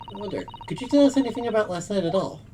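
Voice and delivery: usual speaking voice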